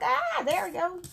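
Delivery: satisfied. Retold or imitated. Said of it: "I'm like".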